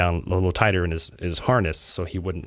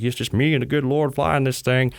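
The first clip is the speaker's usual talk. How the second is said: a hint of a Louisiana accent, pitched a bit higher; lightly imitating a Louisiana pilot's accent; retelling the pilot's words in a light Louisiana accent; 5 semitones higher than usual. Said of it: "funny to hear him in his, his, his accent".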